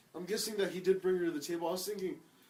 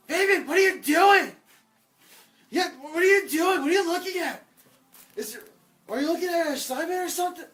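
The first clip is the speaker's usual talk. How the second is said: high, loud, nosy yelling; mock loud classmate; 10 semitones higher, 10 dB louder than usual. Classmate calling out the cheater, loud and high. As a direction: ham up the tattling kid. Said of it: "you just start yelling really loud".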